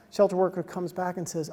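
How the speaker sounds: ordinary talk, nothing marked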